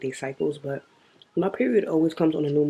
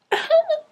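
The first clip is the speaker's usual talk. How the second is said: sudden high burst of laughter; a squealing laugh at herself; 5 dB louder than usual. Real, not acted. Cracking up at her own period story, high laugh.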